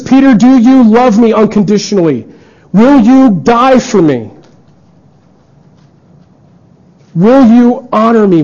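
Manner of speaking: earnest pleading. Acted. Jesus asking Peter the third time, high and earnest. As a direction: plead with rising intensity.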